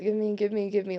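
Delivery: usual speaking voice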